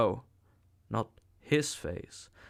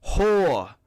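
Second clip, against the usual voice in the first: furious shout; 6 semitones higher, 9 dB louder than usual. A narrator's reading. Savage screaming insult again.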